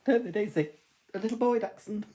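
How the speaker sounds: high, cooing pet talk; talking to the dog. Real, not acted. Cooing to her dog Daisy.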